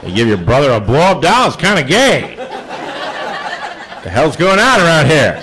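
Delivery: dad losing patience. Retold or imitated. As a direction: imitate a father souring on the joke, pitch climbing. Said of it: "my dad started fading on the joke".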